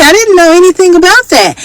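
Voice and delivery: put-on surprised older lady.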